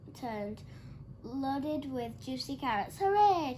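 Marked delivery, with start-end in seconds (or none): 3.0-3.6 high, loud cheer. A narrator's reading. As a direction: cheer together in delight. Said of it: "cheered the animals".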